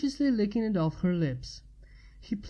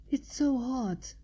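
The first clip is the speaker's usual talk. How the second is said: slow, higher, breathy-sounding gasp of words; panted; 4 semitones higher, slower than usual. Acted. Woman gasping to her partner, panting. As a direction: stretch it out between pants. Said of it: "she panted".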